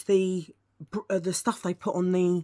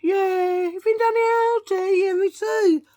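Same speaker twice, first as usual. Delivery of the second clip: much higher and louder mock chatty greeting; mocking herself greeting Margaret in a high, louder put-on voice; silly high voice; 13 semitones higher, 6 dB louder than usual. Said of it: "Imagine me going out and going".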